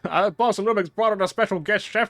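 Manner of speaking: boisterous customer. Acted. Boss's guest voiced loudly.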